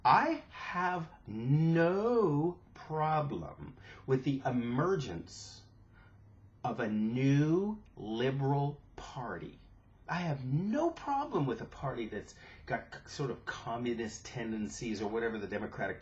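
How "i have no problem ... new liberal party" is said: very slow, every word spelled out; slow, pointed first take; slow and deliberate. Shown as a demonstration. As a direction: drag it out like talking to someone who won't listen. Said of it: "I may have to say it twice".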